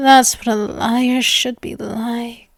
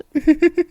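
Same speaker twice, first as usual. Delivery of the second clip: short rising self-satisfied laugh; gloating giggle; 6 semitones higher than usual. Acted. Vampire giggling to her lover about swatting flies. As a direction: giggle over your own cleverness.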